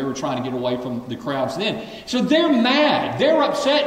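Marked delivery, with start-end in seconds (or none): 2.1-3.8 high, loud preacher build; rising fervor. Acted. Preacher telling congregation the disciples' anger, high and loud. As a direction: climb up into the point.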